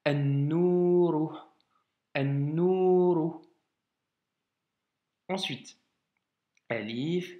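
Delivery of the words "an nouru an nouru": "An-nouru" slow, L silent, doubled n; syllable by syllable, "an-nouru" with a long nn; slow model reading. Shown as a demonstration. Teacher models "an-nouru" slowly for a student, L silent. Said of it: "le lam ne se prononce pas".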